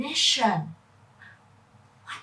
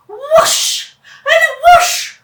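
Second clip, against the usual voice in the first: much louder, much higher, slow; dramatic whoosh; 14 dB louder, much slower than usual. Acted. Narrator springs the surprise of passing jets, loud and high. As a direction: sweep it up like jets flying past.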